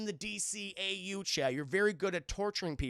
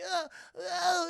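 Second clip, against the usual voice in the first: high mock whimpers of effort; straining whimper; 12 semitones higher, 4 dB louder than usual. Victim straining for rope.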